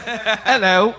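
lightly voiced Cockney hello; Cockney accent. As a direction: toss off a mock Cockney greeting. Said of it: "the old Cockney accent".

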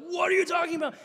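high, loud outraged yell; mock angry shout. Angry crowd in synagogue.

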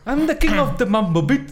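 high, slow, drawn-out showman line; hammed-up high English movie quote; catchphrase imitation. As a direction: belt out a cartoonish catchphrase. Quoting a film catchphrase.

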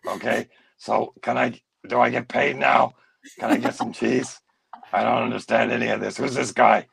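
put-on confused old potato puppet character. Old eyeless puppet grumbling to the interviewer, confused character voice. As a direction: play a muddled, apologetic old puppet.

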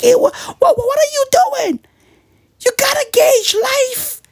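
very high-pitched comic shouting; high, incredulous mock holler; mock outraged challenge. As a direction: yell it in squealing disbelief. Mock confronting people who don't.

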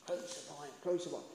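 hushed, low echo of a chant; retold crowd shout. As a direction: repeat the crowd's cry softly. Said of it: "when they shouted out".

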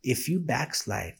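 low, quiet playful warning; mock threat. Friend warning him not to backslide, low mock menace. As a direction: drop low and soft for a teasing threat.

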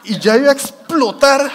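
loud, high, dramatised burst of exasperation; storyteller's mock blow-up; about to explode. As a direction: act out boiling over, loud and high. Retelling being about to explode.

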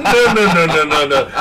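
laughing protest. Retold or imitated. Denying as the mug is hidden.

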